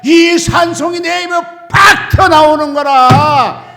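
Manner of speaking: ordinary delivery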